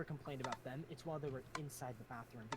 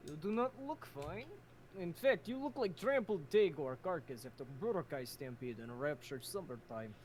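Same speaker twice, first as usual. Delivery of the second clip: put-on Russian accent; 2 semitones higher, 5 dB louder than usual. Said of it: "the Russian accent is on point".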